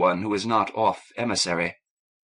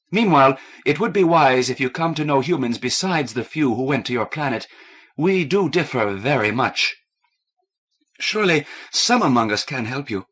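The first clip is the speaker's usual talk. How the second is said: firm encouraging; 4 semitones higher, 4 dB louder than usual. A narrator's reading. Kathleen rallied, firm, bright.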